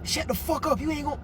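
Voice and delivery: high mock voice of a mouthy schoolkid; rude kid. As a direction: imitate a brat snapping at the teacher. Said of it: "I heard a kid say".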